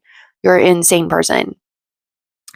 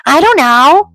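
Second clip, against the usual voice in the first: mock whiny reply; 10 semitones higher, 6 dB louder, slower than usual. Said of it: "And he goes".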